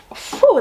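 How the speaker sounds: startled whew. Real, not acted. Reacting to bitter beer.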